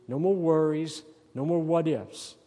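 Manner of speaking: ordinary delivery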